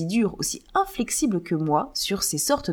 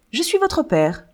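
plus aigu, lancé avec élan; révèle-le vivement; avec vivacité; 7 semitones higher than usual. Acted. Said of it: "reprit Bernold avec vivacité". (Bernold révèle à sa fille qu'il est son père, avec vivacité)

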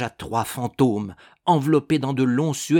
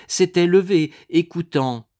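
usual speaking voice

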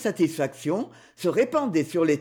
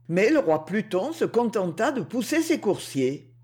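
ordinary delivery, nothing marked

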